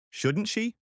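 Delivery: plain reading voice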